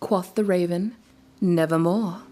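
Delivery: measured, brooding recital of a gothic poem; dramatic poem reading. A narrator's reading. Reading a favorite poem to viewers, dramatic recital. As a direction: read it like a candlelit recital. Reads The Raven aloud.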